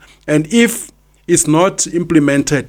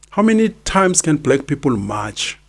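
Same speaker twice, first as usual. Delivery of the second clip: ordinary talk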